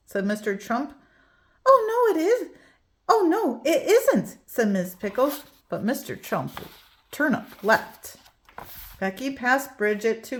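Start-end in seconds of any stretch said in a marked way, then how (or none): 1.7-4.4 flustered. A narrator's reading. Teacher flustered correction.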